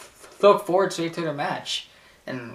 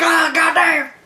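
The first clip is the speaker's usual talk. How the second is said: high, loud mock wail; Paul Heyman; 8 dB louder, slower than usual. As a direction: cry out as if taking a beating. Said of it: "He's like".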